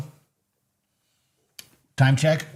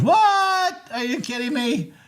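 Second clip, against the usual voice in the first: shocked disbelief; 12 semitones higher, slower than usual. Real, not acted. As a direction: jump up in pitch at the shock. Sees loss on chart, reacts.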